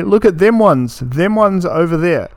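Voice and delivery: imitating Australian "them ones" with a pitch lift; mocking Australian phrasing. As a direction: parody the way Aussies say "them ones". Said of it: "That's how a normal person will say it. People say".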